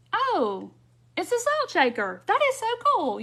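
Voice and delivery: high-pitched, building gush of amazement; imitated admiration. Crafter imitating visitors who spot the salt shaker, gushing admiration. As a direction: mimic an impressed onlooker, pitch climbing.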